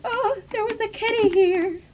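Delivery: high, cooing voice for the kitty find; delighted cat finder. Retold or imitated. Player spotting cat prints, high cooing. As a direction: coo over the cat paw prints. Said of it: "And then you're like".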